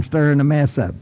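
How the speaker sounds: normal talking voice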